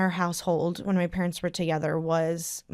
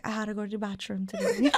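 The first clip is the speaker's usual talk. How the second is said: put-on Trini accent; 6 dB quieter than usual. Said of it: "or I'll be like".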